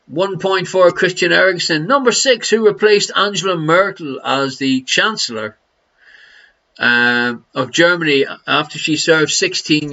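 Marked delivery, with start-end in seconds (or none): none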